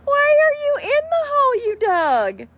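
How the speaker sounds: high, loud disbelieving questions; incredulous. Real, not acted. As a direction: ask in shocked disbelief. Pets dug a hole.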